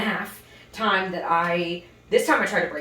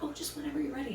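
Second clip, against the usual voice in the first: shy and meek; 7 semitones higher, 10 dB quieter, much faster than usual. Retold or imitated. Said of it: "I used to be so much more shy about that".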